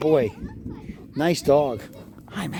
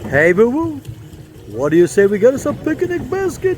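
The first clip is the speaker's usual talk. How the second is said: higher, louder cartoon bear voice; Yogi Bear voice; 6 semitones higher, 3 dB louder than usual. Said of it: "my Yogi Bear imitation".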